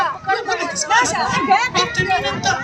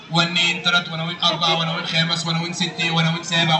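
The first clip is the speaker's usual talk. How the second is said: very low puppet counting voice; Aragoz puppet; 13 semitones lower than usual. Acted. Puppet counting nonsense English for the host, Aragoz voice. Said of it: "الأراجوز ده وهو بيرغي".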